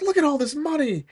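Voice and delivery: high squeal of greed; greedy delight. Retold or imitated. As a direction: marvel at the loot like a crook. Beagle Boys eyeing the money.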